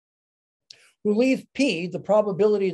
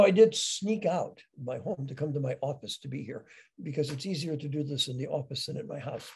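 ordinary delivery

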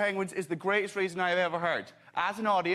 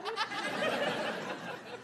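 laugh; 6 dB quieter than usual. Real, not acted.

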